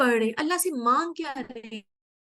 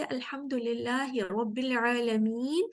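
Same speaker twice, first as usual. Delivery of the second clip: slow recitation; much slower than usual. Shown as a demonstration. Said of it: "ان کے پیچھے".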